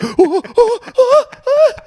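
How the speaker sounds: high, loud panicky "oh, oh"; mock panic. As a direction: imitate kids about to panic. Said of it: "on the verge of a panic attack".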